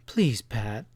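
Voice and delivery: emotional pleading voice; throbbing plea. Acted. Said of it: "Sally's voice throbbed strongly".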